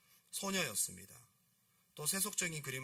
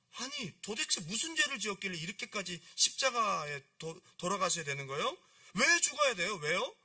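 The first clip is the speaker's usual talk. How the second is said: loud, raised acted disbelief; insistent questioning; 6 semitones higher, 7 dB louder than usual. Girl asking the painter why Jesus must die, insistent. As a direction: demand to know why, climbing to a high, loud peak. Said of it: "페피타가 막 묻기 시작합니다".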